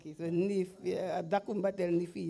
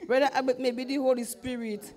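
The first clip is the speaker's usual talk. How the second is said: laughing, a little louder and higher; 5 semitones higher, 5 dB louder than usual. Real, not acted. Laughing as she tells Brother Albert. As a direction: laugh it out to the pastor.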